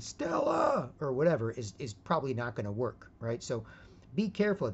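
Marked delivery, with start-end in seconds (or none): 0.0-0.9 mock Brando howl, higher; Stella cry. Mocking texting a breakup plea, mock howl. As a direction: toss off the famous cry as a joke.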